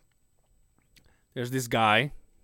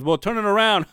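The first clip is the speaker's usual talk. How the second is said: raised but less forceful punchline; half-assed punchline; 8 semitones higher, 8 dB louder than usual. Retold or imitated. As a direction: toss off the punchline as a careless retelling. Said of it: "he tells it half-assed".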